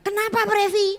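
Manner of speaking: high, loud, urgent asking; alarmed mother's question. Retold or imitated. Mother asking her child what has happened, alarmed and loud. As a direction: call out sharply to the child, pitched high. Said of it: "Saya tanya dia".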